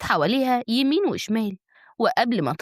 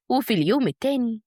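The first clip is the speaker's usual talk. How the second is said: ordinary delivery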